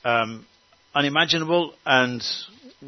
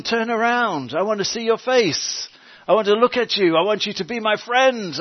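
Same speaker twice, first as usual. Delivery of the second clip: higher, pleading voice of God calling his people back; 7 semitones higher than usual. Acted. God calling his estranged people home, higher and pleading. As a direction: beg them to turn around, lifted high. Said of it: "He says".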